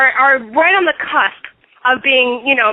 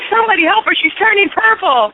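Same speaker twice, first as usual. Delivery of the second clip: mock alarm; 8 semitones higher than usual.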